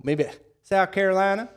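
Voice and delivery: playful South Carolina accent attempt. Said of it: "Oh, the South Carolina accent".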